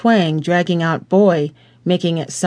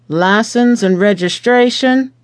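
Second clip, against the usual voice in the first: stern cop's demand, a touch higher; demanding officer; 5 semitones higher, 4 dB louder than usual. A narrator's reading. Officer demanding papers at a traffic stop, stern. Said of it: "demanded the officer".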